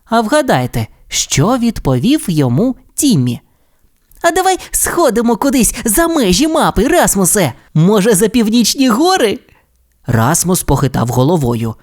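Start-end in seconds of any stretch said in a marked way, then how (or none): 4.2-9.4 put-on high, eager childlike voice; adventurous young dinosaur. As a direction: tempt your friend into an adventure. Timmy proposes an adventure.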